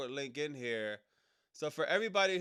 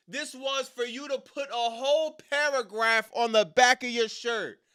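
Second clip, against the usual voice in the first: high, loud disbelieving yell; incredulous rant; 11 semitones higher, 7 dB louder, slower than usual. Real, not acted. Streamer yelling at Chad Gable over his merch, high and loud. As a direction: shout in real disbelief at the shirt.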